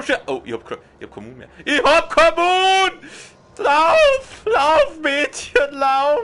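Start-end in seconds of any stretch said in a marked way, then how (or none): 1.7-3.0 high, loud panicked shout. Real, not acted. Panicked outburst at game. 3.6-6.2 high, loud panicked cries; panicked urging. Real, not acted. Urging character to run.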